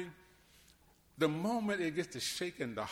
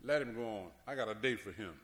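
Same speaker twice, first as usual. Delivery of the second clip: calm God; 6 semitones lower, 4 dB quieter than usual. Retold or imitated. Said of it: "When God says".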